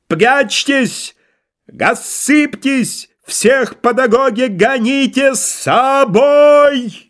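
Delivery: high, slow, howled command with burred r; картавый командирский вой. Acted. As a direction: bellow orders, swallowing every r. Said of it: "он взвыл команду необычным, неслыханным картавым голосом". (картавый протяжный крик команды)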